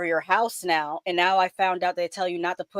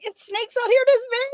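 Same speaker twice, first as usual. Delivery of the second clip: much higher, hammed-up movie panic; 13 semitones higher than usual. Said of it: "[name] and Anaconda".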